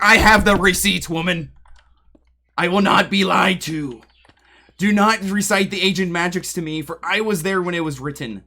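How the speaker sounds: loud, grandly theatrical declaration; mock dramatic indignation. Streamer retorting to the friend who claimed she wasn't in the call, hammed-up and loud. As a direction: proclaim it like an offended epic hero. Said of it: "It's like".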